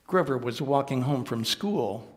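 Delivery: plain reading voice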